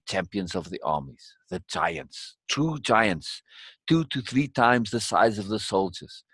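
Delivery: measured, even storyteller voice; story narration. A narrator's reading. Reading the tale of an emperor's army, story narration. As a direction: narrate the scene steadily.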